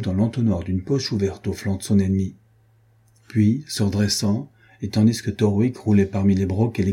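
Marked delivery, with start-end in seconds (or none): none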